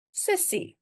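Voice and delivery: "Sussie" high, as a careful model; demonstrated pronunciation. Shown as a demonstration. Teacher repeats "Sussie" for learners, careful and high. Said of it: "it is pronounced sussie".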